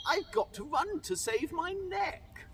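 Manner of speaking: high-pitched hurried cry; urgent shout. Acted. Cindy at midnight, high urgent cry. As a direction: say it in a rush to flee. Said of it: "He shouted".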